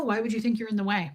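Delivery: ordinary delivery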